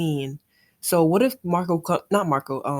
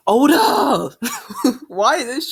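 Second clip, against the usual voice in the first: mock outcry at author; 5 semitones higher, 4 dB louder, slower than usual. Real, not acted. Blaming the author for sadness.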